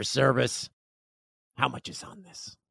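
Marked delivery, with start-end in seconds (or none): 1.6-2.6 daughter's shy whisper at the counter; whispered question. Retold or imitated. Daughter whispering to the clerk about her gift card, whispered. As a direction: breathe it out, voiceless and secret. Daughter asks at customer service.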